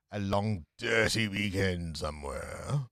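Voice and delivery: low, slow, plummy radio psychiatrist; Frasier Crane voice. Retold or imitated. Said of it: "That's my best Fraser Crane voice".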